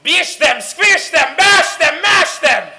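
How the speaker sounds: high, loud, punched war chant; fierce chanted shouting; rallying shout. Acted. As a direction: fire off each command to the mob. Witch leads violent chant.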